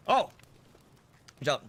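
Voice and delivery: usual speaking voice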